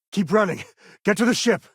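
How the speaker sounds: urgent shouted order to flee. Acted. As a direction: yell at them to keep moving. Urging escape, calling to companion.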